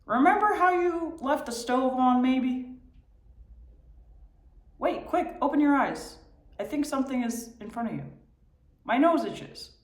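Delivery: high, pestering mind chatter; mocking inner voice. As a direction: needle yourself like a nagging brain. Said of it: "mimicking and mocking what's going on in my head".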